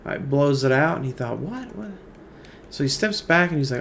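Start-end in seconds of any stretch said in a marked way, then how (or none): 1.4-1.9 higher, hushed double-take; soft startled what. Retold or imitated. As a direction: catch yourself hearing something odd. Pilot hears the parrot.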